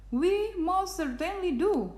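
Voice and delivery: high little piping voice. A narrator's reading. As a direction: pipe up high. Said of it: "piped the voice".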